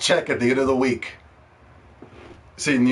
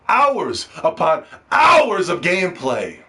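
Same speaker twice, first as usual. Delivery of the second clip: hours stretched and louder, slower still; louder slow build; 3 semitones higher, 4 dB louder, slower than usual. Shown as a demonstration. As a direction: lean harder into hours.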